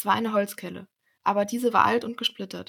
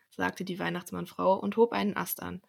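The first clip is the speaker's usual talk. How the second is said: plain reading voice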